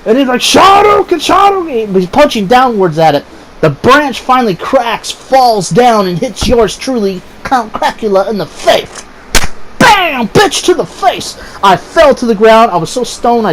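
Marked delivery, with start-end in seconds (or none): 0.4-1.9 high, hammed-up fighting cry; game attack yell. Stoned friend punching downwards at a branch, high mock yell. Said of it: "I don't know why he yells this". 9.8-11.4 high, drawn-out comic blow; impact exclamation. Retold or imitated. Storyteller showing the branch hitting his face, high hammed-up exclamation.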